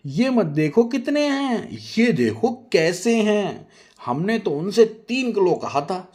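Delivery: slow, high, loud lecturing; haughty. Acted. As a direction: lay it down slowly and proudly. Nazia defending the sweets.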